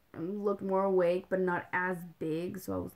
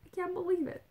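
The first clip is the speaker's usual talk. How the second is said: amazed disbelief; 10 semitones higher than usual. Retold or imitated. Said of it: "so it's kinda like".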